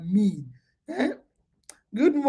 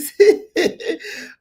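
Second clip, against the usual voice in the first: high laugh; 9 semitones higher, 4 dB louder than usual. Real, not acted. Laughs again.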